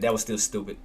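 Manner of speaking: muttered self-blame. Real, not acted. Trader blaming himself, soft defeated muttering. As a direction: mumble it, beaten.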